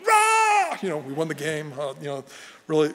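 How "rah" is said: high, loud mock cheer from the stands; crowd roar imitated. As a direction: belt it like a whole stadium cheering. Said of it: "The whole crowd was screaming".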